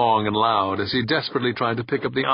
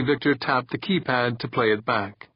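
plain reading voice

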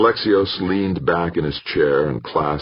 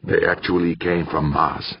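ordinary delivery

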